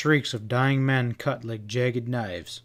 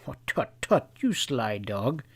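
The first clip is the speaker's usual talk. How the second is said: clucked reprovingly. A narrator's reading. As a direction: chide him playfully. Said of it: "the Englishman clucked reprovingly".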